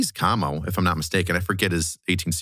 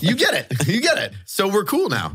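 mock bro; 11 semitones higher than usual. Mocking high agreement.

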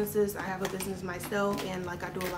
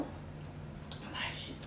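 whisper; 12 dB quieter, faster than usual. Real, not acted. IPad turning on.

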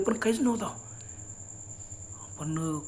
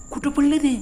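sudden louder, higher call into the dark; alarmed shout; 6 semitones higher, 6 dB louder than usual. Retold or imitated. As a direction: yell it out in alarm. Searching for vanished companions.

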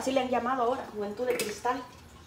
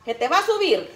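higher, louder motherly caution; mother's warning; 9 semitones higher, 6 dB louder than usual. Retold or imitated. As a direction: warn her like a watchful mom. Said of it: "Así me decía mi mamá".

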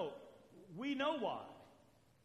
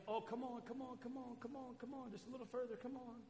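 soft, wheedling come on; quietly luring them just a little further; coaxing tease; 2 semitones higher, 9 dB quieter than usual. Retold or imitated. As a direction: coax someone along like dangling a carrot. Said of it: "dangling a carrot ... saying".

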